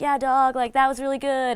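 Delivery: breezy praise, a light take on a cool judge; hint of a chill judge hyping them up; Randy impression. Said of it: "as Randy would say".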